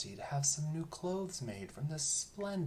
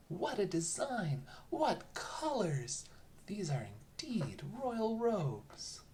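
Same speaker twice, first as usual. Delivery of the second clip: marvelling; 8 semitones higher, slower than usual. A narrator's reading. Crowd marvelling at robes.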